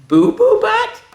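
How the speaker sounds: very high, loud squeak of disbelief; comic incredulity. Acted. Reader questioning hippo's name, squeaky disbelief.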